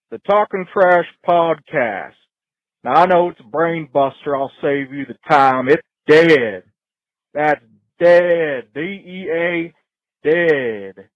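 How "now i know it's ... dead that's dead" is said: ordinary talk, nothing marked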